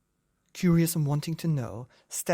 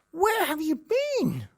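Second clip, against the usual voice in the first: a much higher, slow scream; screaming mother; slower than usual. Acted. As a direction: shriek it up to the rafters. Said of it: "she screamed to high heaven".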